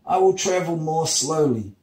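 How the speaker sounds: high voice of the yielding sun; acted reluctant surrender; defeated sun. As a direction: promise it in a high, pained character voice. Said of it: "he agreed".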